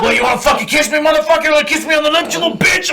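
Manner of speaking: high shouted mock tirade; yelling drill instructor. Gunny yelling in a recruit's face, high-pitched shout. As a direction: shout insulting questions at a recruit. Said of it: "when he would yell at me".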